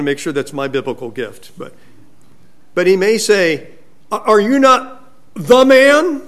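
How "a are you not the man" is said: high, loud challenge leaning on "the man"; sarcastic taunt. Retold or imitated. David needles Abner as THE man, loudly sarcastic. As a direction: hammer "the man" louder and higher, as a taunt. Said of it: "very sarcastic".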